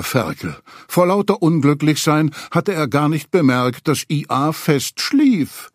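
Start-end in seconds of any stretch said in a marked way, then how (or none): none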